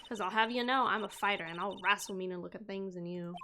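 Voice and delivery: put-on light Southern accent, bold and sassy. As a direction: play her as a feisty Southern gal. Voices Southern witness Lotta.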